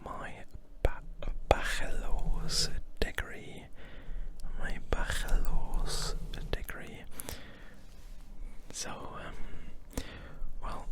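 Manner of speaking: hushed, unvoiced whispering; ASMR whisper. Real, not acted. ASMR host chatting to viewers about moving to Berlin, whispering. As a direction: whisper closely, lingering on repeated words.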